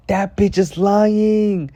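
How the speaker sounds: high, loud, drawn-out accusation; outraged outburst. Real, not acted. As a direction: call out the lie at full voice, stretching it. Outraged reaction to apology text.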